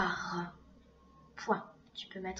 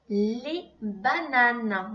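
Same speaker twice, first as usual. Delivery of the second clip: "Les bananes" very slowly and louder; slow dictation; 5 dB louder, much slower than usual. Shown as a demonstration. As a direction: drag out "Les bananes" for writing. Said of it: "Plus loin".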